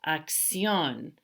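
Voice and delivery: "acción" with a long, stressed final "ción"; stress on last syllable. Shown as a demonstration. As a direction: lean hard on the ending of "acción". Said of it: "actually on the last syllable".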